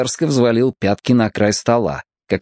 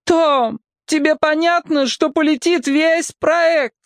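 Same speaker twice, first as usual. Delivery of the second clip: high, slow moaning plea; slower than usual. A narrator's reading. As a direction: moan the question in despair. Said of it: "со стоном спросила".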